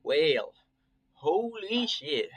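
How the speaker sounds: slow, amused Southern drawl on holy shit; lazy Southern accent, amused. Acted. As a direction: lay on a Southern drawl for the stranger. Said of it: "like, a southern accent".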